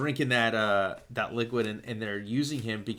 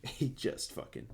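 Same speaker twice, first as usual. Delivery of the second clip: soft, breathy laughing aside; 9 dB quieter than usual. Real, not acted. Reviewer relishing a favorite fight scene, laughing. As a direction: laugh through the words.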